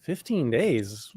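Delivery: slow, drawn-out "Fifteen days?" in disbelief; surprised. Retold or imitated. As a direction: stretch "Fifteen days?" as if it can't be true. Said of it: "And I was like".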